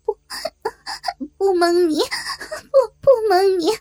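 stammered laughing-crying denial. Acted. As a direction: stumble out the denial through laughs and tears. 慌乱中笑着哭着否认. (又笑又哭、结结巴巴地否认)

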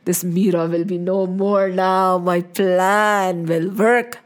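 low, drawn-out scheming voice; villain's gloating thought. Acted. Rana plots Meera's end in his heart, gloating. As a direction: relish the wicked plan, slow and low. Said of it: "In his heart, he was thinking".

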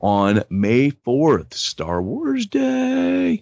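slow, high showman reveal of Star Wars Day; dramatic announcement. Pretend. Announcing his interview date to the cohost, slow and grand. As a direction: drag it out like a big reveal.

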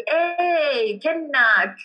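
drawn-out Hadrami "Hey, kennak" spoken up high; Hadrami stretched words. Shown as a demonstration. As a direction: stretch each word the Mukalla way, "kennak" held long. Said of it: "you will notice that I extend the word".

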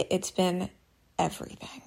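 softly, almost under the breath; quiet. Real, not acted. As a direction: let the last word fade to nothing. Heartfelt confession, voice drops away.

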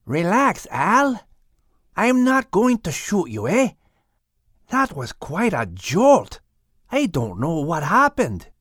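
lightly voiced, relaxed Canadian skunk; calm Al down, unbothered; a hint of a laid-back Canadian skunk; easygoing skunk. Rodney skunk voice.

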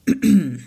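sharp throat clear. Acted. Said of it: "Lightfin cleared his throat".

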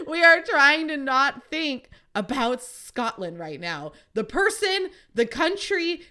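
mock plead with him to drop it; exasperated retort. Daughter pleading with dad to drop Scotland, loud and exasperated.

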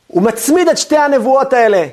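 normal talking voice

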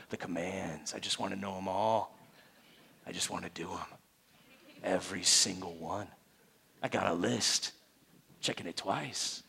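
low, soft, savoring confession; hushed longing. Acted. Preacher playing a disciple who loves the commands, hushed longing. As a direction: drop to an intimate, reverent hush. Acts out a devoted disciple's craving.

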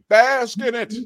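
high, loud, drawn-out bask in it; Thugnificent. Retold or imitated. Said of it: "as, uh, Thugnificent would say".

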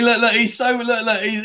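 ordinary talk

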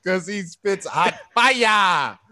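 slow, drawn-out mock hype. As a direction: ham up the praise slowly. Hyping up Dylan jokingly.

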